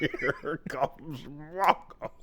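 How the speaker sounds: slow whisper through laughter; laughing whisper. Retold or imitated. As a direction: whisper it slowly, giggling. Quoting again, laughing.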